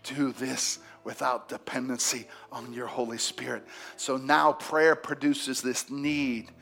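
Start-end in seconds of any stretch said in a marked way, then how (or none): 0.0-3.7 humble plea. Retold or imitated. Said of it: "it was like".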